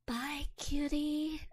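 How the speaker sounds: slow flirty goodbye. Acted. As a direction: stretch it out, teasing. Flirty farewell to crush.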